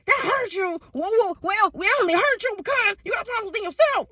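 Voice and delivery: hammed-up dismissive retort; mocking comeback. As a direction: throw it back in a high, jeering voice. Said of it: "you like".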